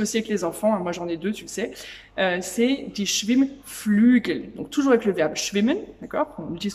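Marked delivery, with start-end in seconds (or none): 2.4-4.5 "das Schwimmbecken" drawn out slowly and clearly; teacher modelling a German word slowly; slow vocabulary demo. Shown as a demonstration. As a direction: present the new word slowly to the class. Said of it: "Je vais t'apprendre du vocabulaire".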